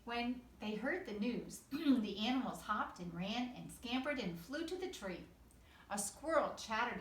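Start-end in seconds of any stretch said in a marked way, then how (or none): none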